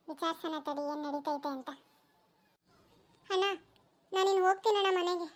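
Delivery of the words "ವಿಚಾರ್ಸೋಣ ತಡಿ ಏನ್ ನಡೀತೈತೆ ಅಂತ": low, soft aside; muttered to himself. Acted. A villager plots to himself, low and soft. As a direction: mutter the plan under the breath.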